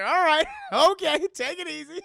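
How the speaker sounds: high, loud, laughing "take it easy"; laughing calm-down. Retold or imitated. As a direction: soothe the ghost while cracking up. Retold calming line with laughter.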